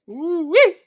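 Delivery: high, loud whoop of delight; gleeful fan whoop; excited whoop. Real, not acted. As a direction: cheer it out like a fan. Excited cheer about Foundation.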